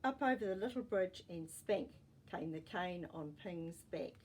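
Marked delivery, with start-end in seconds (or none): none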